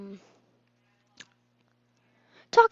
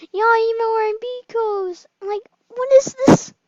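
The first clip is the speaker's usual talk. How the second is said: high, squeaky imitation of a girl; mock a girl's squeaky voice; 11 semitones higher, 9 dB louder than usual. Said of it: "She said".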